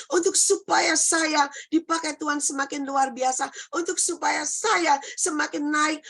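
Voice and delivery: impassioned sermon build; fervent preaching. Real, not acted. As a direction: pile up the reasons with mounting fervour. Preaching with rising force.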